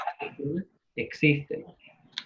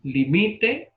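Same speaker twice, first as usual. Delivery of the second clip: higher "Limite", said li-MI-te; stress on the middle syllable; 4 semitones higher than usual. Shown as a demonstration. Teacher starting an example, higher, MI stressed in "Limite". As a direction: lift the pitch and stress MI in "Limite". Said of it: "Palabra llana con pronunciación en la".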